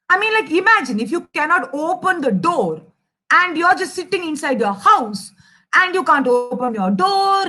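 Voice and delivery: usual speaking voice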